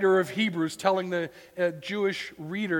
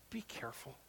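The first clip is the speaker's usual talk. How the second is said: much softer, gentle warning; tender plea; 7 semitones lower, 19 dB quieter than usual. Shown as a demonstration. Pastor echoing the verse, softly pleading. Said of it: "Do you hear the pastor's heart there?".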